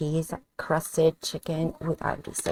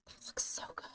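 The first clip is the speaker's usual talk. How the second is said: hushed whisper, almost to herself; 17 dB quieter than usual. Real, not acted. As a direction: whisper it close to the mic. Admiring the plate, whispering.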